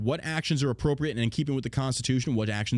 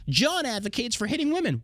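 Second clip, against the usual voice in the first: mock headline; 11 semitones higher than usual. Mock headline about himself.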